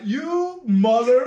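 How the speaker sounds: high, loud, slow outraged curse; angry retort. Retold or imitated. Barber cursing the coworker who mocked his haircut. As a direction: let the insult burst out high and hot. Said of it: "And I was like".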